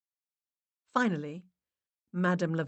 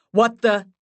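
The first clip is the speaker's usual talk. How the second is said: loud, raised-pitch exclamation; startled outburst; 5 semitones higher, 12 dB louder than usual. A narrator's reading. Max interrupting the séance, startled outburst. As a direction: blurt it out in disbelief.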